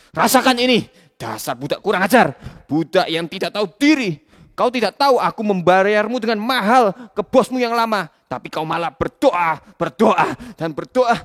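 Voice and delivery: loud angry tirade; furious master. Acted. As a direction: bark cruel orders. Boss whipping Kaf.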